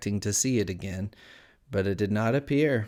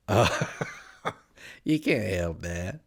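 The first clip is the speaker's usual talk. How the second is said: slow, drawn-out cat character voice; sly Cheshire Cat; 2 semitones lower, much slower than usual. A narrator's reading. Cat answering Alice's question, slow and teasing. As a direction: stretch the words out in lazy riddles.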